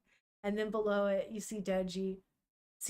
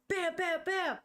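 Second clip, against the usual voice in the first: knocking sounds; 8 semitones higher, slower than usual. Retold or imitated. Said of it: "you hear knocking on the door".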